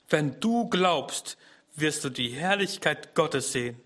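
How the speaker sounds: very slow on wenn du glaubst, then easing; slow emphatic quote. Shown as a demonstration. Preacher repeating Jesus' promise to listeners, slow emphasis. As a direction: let each word land slowly. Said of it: "repeated slowly for emphasis".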